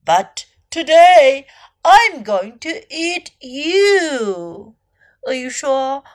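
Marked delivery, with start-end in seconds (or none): 0.0-4.7 slow, louder storybook threat; menacing crocodile. A narrator's reading. Crocodile tells elephant he's the dinner, slow menace. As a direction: draw out each word as a hungry threat.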